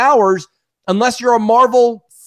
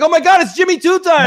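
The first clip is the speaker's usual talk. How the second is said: high, loud fake amazement; hammed-up superfan cry; mock fan excitement; 10 semitones higher, 5 dB louder than usual. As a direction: imitate fans going wild over a minor villain. Mimicking excited fans again.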